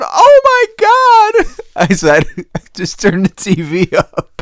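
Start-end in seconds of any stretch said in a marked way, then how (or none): 0.0-1.6 high, drawn-out imitation shriek; hammed-up horror, very high and slow; mock horrified cry. Acts out partner's horrified reaction. 2.7-4.3 laughing retelling. Retold or imitated. Laughing while retelling own reply.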